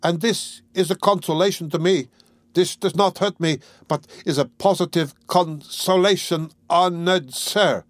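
put-on high, slow, meek drunkard's plea; called out. A narrator's reading. A hint of pitiful, slow wheedling. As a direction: drunk husband dragged by hair calling to guest. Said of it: "he called out".

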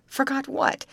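high, curious, smiling; smiling question. A narrator's reading. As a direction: ask it with a smile. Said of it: "asked Betty with a smile".

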